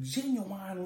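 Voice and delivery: "genuine" with its ending said like "wine"; playful mispronunciation. Shown as a demonstration. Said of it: "I like seeing genuine".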